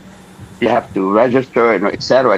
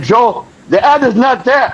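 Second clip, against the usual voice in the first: higher-pitched, exasperated complaint to the boss; a mild mock outburst, the third time the ad is missing; retold anger; 9 semitones higher than usual. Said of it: "I was mad".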